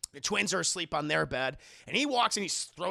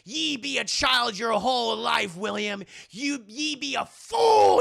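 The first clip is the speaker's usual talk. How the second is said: loud, rising archaic rebuke; mock scornful tirade; 4 semitones higher, 7 dB louder than usual. Mother berates husband William, scornful shout.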